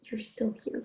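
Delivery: unvoiced shocked hush; whisper. Real, not acted. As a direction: breathe it in horror. Startled hushed realization.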